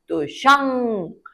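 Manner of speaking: शंख drawn out slowly, nasal ng held; slow teaching demo. Shown as a demonstration. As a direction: say शंख slowly, letting the ng hum through the nose. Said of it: "आवाज आ रही है अंग की".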